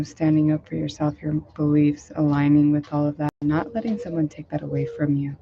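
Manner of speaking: ordinary delivery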